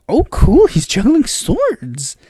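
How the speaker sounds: drawn-out, high-starting gush of mock awe; impressed onlooker. Onlookers admiring Joe juggling swords, mock impressed. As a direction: voice an amazed bystander. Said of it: "Everything's like".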